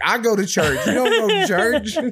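high, laughing mock of teen self; laughing mimicry. Son laughing at his old preachy self, high mimicry. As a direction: giggle through it, mocking your younger self.

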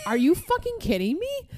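high-pitched incredulous retort; upset disbelief. Retold or imitated. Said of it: "upset I was".

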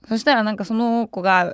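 ordinary talk

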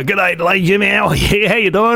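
lightly voiced stage character greeting; Richie Raganu. Comic opening his festival show, in character. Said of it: "I was starting doing this character".

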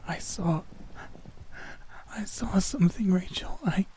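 high, whispery, halting gasps; struggling for breath. A narrator's reading. Hyperventilating roommate telling her friend, breathless. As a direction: force words out between breaths. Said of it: "struggled to bring her breathing under control".

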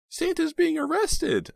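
put-on high slow child's alarmed call. A narrator's reading. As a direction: call out like a frightened kid. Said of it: "an alarmed child called".

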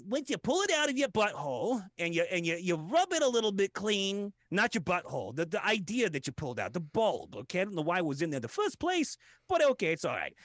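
normal talking voice

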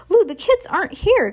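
high, urgent shout across aisles; alarmed call. A narrator's reading. Shopkeeper warns the delivery person kids are gone, alarmed call.

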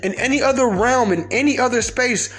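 high-pitched, emphatic angry rant; real anger, key words stretched out; gigantically mad. Real, not acted. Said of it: "gigantically mad".

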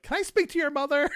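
friend's mother wants a grown-up on the line, stern and high. Retold or imitated. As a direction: demand it like a suspicious parent. Friend's mother demanding a parent.

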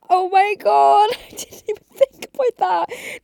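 laughing realisation. Retold or imitated. Replying to her sister about dad's snoring, laughing. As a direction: laugh as it dawns on you.

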